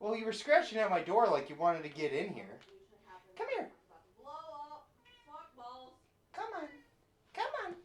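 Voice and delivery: very high, soft coaxing call; high soft cat call. Real, not acted. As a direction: coax the cat gently. Calling the cat sweetly.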